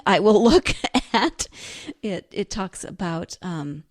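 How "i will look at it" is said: laughing. Real, not acted. Laughs at her own habit.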